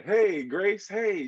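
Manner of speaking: high, bright call up to the sky; cheerful shout. A narrator's reading. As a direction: greet the sky with joy. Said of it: "she shouted".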